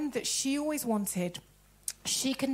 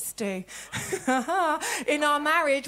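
normal talking voice

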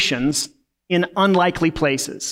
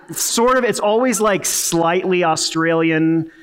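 ordinary talk, nothing marked

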